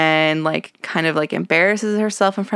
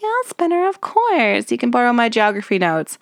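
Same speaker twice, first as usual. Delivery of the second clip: high girly mock voice, much higher; Terry impression; 5 semitones higher than usual. Imitating Terry answering Spinner in a high girly voice. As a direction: squeak it out like an eager teen girl. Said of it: "And Terry's like".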